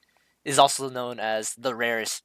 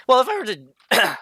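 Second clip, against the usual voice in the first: voice crack; 10 semitones higher, 8 dB louder than usual. Real, not acted. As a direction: voice cracking high and loud. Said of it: "man, voice crack".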